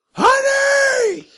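high-pitched shouted reveal; dramatic shout. Acted. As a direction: belt out the punchline word. Narrator's dramatic reveal to players.

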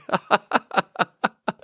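high, rapid chuckle; high giggle. Real, not acted. Telling a buddy's dating story, high delighted giggle. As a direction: giggle at your own story.

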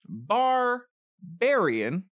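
stretched very slowly with a rising end; drawn-out repeat. Shown as a demonstration. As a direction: savor each syllable of Barbarian on request. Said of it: "Can you say that again?".

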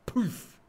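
sudden loud, high "poof"; comic impact sound, punched out; mock punch noise. Host imagining a smack with a cod, loud poof.

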